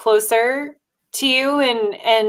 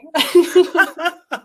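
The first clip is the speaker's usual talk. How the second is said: high bursts of real laughter; shared laughter; 8 semitones higher than usual. Real, not acted. Two podcast hosts laughing together, high real laughter.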